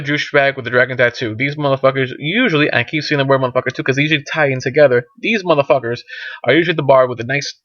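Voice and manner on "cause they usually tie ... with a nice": ordinary talk, nothing marked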